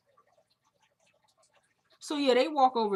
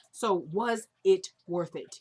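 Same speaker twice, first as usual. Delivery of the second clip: slow, word-by-word "was it worth it"; drawn-out "was ... it ... worth it", each word set apart; separating every word; 3 dB louder, slower than usual. Retold or imitated. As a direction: space out WAS, IT, WORTH IT deliberately. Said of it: "he separates every".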